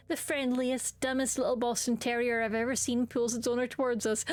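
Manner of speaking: ordinary talk, nothing marked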